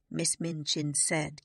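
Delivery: plain reading voice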